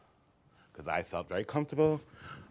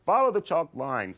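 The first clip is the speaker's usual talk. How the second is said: coach instruction; 6 semitones higher, 6 dB louder than usual. Retold or imitated. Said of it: "He goes".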